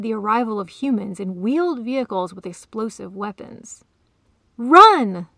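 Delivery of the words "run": loud warning cry; sudden, very loud yell; urgent shout. A narrator's reading. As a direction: shout it like an alarm. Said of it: "the cat shouted".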